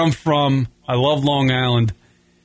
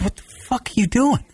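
exasperated thought, higher up; annoyed inner thought; 8 semitones higher than usual. Retold or imitated. Seeing an asshole do something stupid, annoyed. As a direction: think it at the fool in front of you. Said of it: "think in your head".

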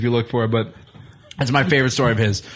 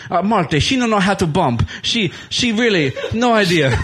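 higher, hammed-up Sheik accent, cracking up; Iron Sheik impression; 6 semitones higher than usual. Sheik defending himself to Marty, higher mock Sheik accent. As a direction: explain it in a badly faked Sheik accent, half laughing. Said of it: "I have a terrible Sheik impersonation".

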